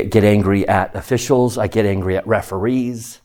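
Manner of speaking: ordinary talk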